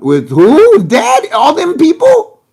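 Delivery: loud, high incredulous questioning; hammed-up outraged disbelief; mock shock. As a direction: act scandalized, pitch shooting up. Mock shock at dinner company.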